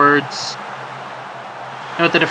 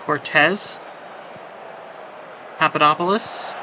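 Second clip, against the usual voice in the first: ordinary delivery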